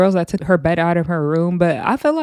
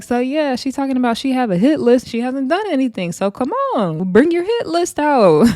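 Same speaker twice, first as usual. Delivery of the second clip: higher, mocking call-out, slowing on "bring your hit list out"; taunting dare; 6 semitones higher than usual. Coco calling Jaden out in the house, mock taunt. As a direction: dare her to show it. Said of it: "Coco is like".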